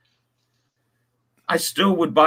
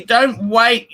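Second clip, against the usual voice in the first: ordinary talk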